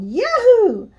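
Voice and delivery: very high, loud whoop; Elmo yelling. A narrator's reading. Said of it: "I yelled".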